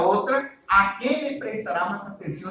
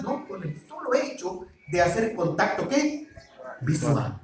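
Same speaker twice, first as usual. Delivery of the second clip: usual speaking voice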